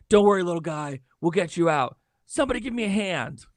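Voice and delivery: lightly acted rescuer, pitch climbing to a call for help; Dom Sellers. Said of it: "He's like".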